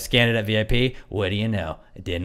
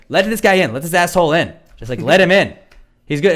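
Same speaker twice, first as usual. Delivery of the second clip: calling out; 6 semitones higher, 5 dB louder than usual. Retold or imitated. Girl calling out to staff.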